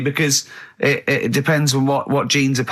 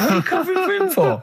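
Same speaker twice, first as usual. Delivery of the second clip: mock accuser; faster than usual.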